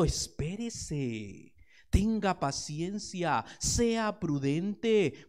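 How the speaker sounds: very slow, each word drawn out; slow and patient. Real, not acted. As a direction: stretch it out, calming the hearer. Preacher counsels patience slowly.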